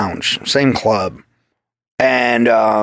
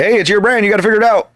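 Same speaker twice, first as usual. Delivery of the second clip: bossing him out the door, half joking; imitating Jesse; 7 semitones higher than usual. Mentor Jesse telling him to go fail, higher mock scolding.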